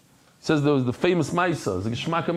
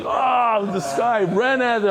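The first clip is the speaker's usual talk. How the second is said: mock shout; 8 semitones higher, slower than usual. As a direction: yell as the horseradish hits. Guest exclaims about bitter herbs.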